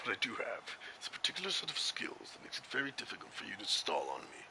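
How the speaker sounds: very quiet, low, near-whispered threat; movie tough-guy parody. Acted. Commentator parodies the Taken speech, hushed menace. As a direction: deliver a hushed, menacing monologue.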